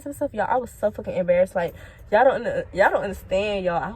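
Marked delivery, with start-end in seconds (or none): none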